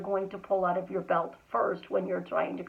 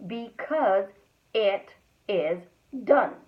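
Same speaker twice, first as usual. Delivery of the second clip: "Because it is done" broken up by pauses; deliberate, separated "Because... it is... done"; slowed down. Shown as a demonstration. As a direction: hold back "done" for emphasis. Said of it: "just slowing down your words".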